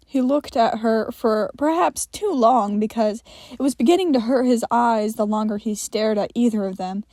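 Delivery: plain reading voice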